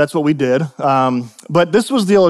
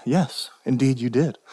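slow, soft, lower reply; very calm; 4 semitones lower, 8 dB quieter than usual. Acted. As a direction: answer gently and unhurriedly, dropping your voice. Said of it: "we must answer very calmly".

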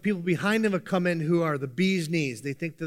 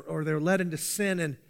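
ordinary talk, nothing marked

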